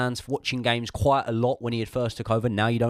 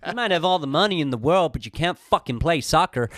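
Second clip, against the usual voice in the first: hammed-up American, higher-pitched; American accent; 5 semitones higher, 3 dB louder than usual. Playing Todd Boehly insulting himself in the mirror, hammed-up American. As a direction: lay on a mock American accent. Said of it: "Can you do it in the accent, please?".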